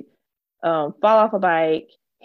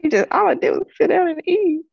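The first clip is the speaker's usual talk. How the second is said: laughing; 10 semitones higher, 4 dB louder than usual. Pretend. Mock-crying about the back.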